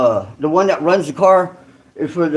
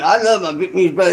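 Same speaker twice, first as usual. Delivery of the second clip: ordinary talk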